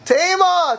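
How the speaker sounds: high, loud cry of Tema; shocked exclamation. Shown as a demonstration. Teacher showing how shocked Tosfos is, exclaiming. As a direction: exclaim it as if stunned. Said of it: "Tosfos is, is shocked".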